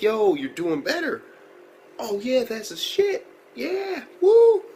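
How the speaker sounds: slow, rising hype-up, ending in a whoop; hammed-up celebration; acted cheering. Acts out friend cheering a recovery.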